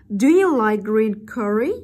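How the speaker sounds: loud "Do you like green curry?" climbing at the end; rising intonation. Shown as a demonstration. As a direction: send "curry" upward. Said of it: "ngữ điệu đi lên". (nói to, lên giọng ở "curry")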